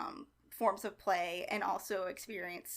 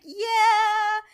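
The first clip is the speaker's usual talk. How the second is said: much higher, loud drawn-out yeah; emphatic yeah; 13 semitones higher, 12 dB louder than usual. Retold or imitated. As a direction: stretch it out big and knowing. Matt confirming he knows Harvey.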